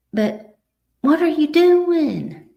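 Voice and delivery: high, loud, drawn-out self-reproach; self-scolding. Retold or imitated. Woman taking a hard look at herself, scolding.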